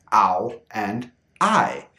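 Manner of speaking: "ow" and "I" said slowly as pure open diphthongs; slow, careful "ow and I" pure sounds. Shown as a demonstration. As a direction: draw out "ow" and "I" slowly and cleanly. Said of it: "I pronounce them in their pure sound".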